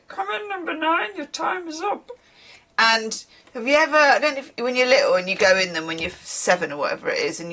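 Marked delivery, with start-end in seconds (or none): 0.1-2.1 mock pedalo attendant's call, pitched high; boat attendant calling in. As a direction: imitate calling a hire boat back in. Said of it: "the one where they go".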